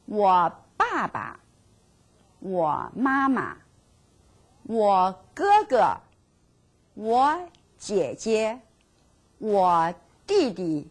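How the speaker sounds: each family word said slowly for repeating; slow, clear drill for the class to echo. Shown as a demonstration. Teacher leads the family words drill, slowly. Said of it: "Let's work together".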